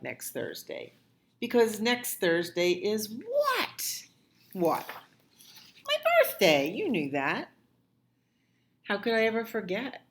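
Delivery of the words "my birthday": excited. Acted. Child announces birthday.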